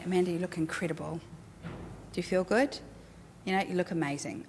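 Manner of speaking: soft, quick, sincere praise; quietly mean every word; sincere compliment. Shown as a demonstration. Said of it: "I'll say".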